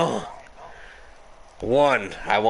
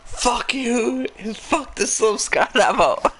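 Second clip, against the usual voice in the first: much higher, hammed-up rage; mock flip-out; 14 semitones higher, slower than usual. Imitating a racer who flips out, mock rage. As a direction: imitate a friend's squealed tantrum.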